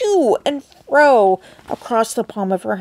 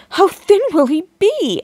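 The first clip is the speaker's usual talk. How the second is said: anxious; 8 semitones higher, 3 dB louder than usual. A narrator's reading. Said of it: "asked Missus T V anxiously".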